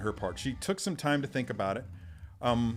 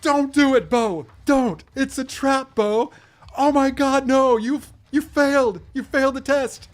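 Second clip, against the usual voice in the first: much higher, much louder frantic yell; yelling at the screen; 13 dB louder, slower than usual. Retold or imitated. To chat, reenacting yelling at the TV, frantic shout. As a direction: scream a warning at a character on TV. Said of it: "crying out at the screen".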